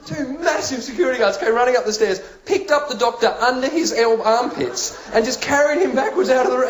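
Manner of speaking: ordinary talk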